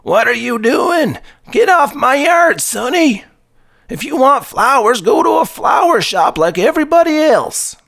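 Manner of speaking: put-on furious old neighbor yelling, high; shouting at trespasser. A narrator's reading. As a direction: yell at the kid in your garden. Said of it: "angrily chasing after him".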